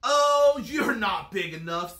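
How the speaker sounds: loud dismissal, high drawn "Oh"; grouchy bug backing out with bluster; grouchy ladybug. A narrator's reading. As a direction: brush them off loudly. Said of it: "said the grouchy ladybug".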